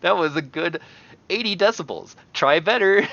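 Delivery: loud, high-pitched mocking game monster; loud arcade-creature voice; voicing a monster rating yells. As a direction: crow a backhanded verdict on a yell.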